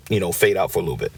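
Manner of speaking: usual speaking voice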